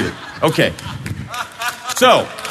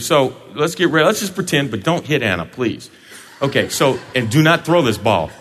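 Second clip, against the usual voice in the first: ordinary delivery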